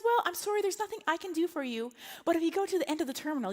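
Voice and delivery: mimicking a sweet, unhelpful agent; airline agent. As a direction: apologize in a customer-service lilt. Agent brushes off stranded traveler.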